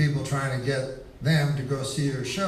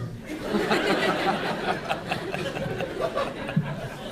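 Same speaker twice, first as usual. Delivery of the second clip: breathy giggle before the story; can't hold back the laugh; giggling laugh; 5 semitones higher than usual. Real, not acted. Comic giggling to the crowd before the punchline.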